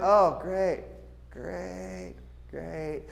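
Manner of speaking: fading flat sarcasm; sarcastic bored kid. Retold or imitated. Kid stuck in a dull Sunday class, sarcastic fading. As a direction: sink into boredom, trailing off.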